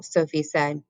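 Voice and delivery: ordinary delivery, nothing marked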